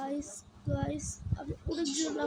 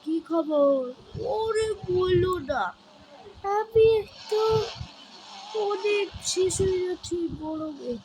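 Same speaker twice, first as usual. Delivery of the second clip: playful character voice; 7 semitones higher, 6 dB louder than usual. Acted. Playful scripted chat with madam.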